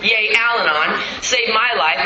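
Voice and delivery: normal talking voice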